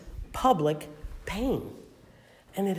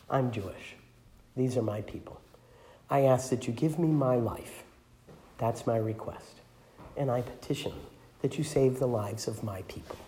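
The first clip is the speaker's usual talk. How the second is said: quiet plea; 6 semitones lower, 9 dB quieter than usual. Acted. Esther pleads before the king.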